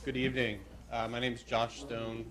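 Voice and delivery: ordinary delivery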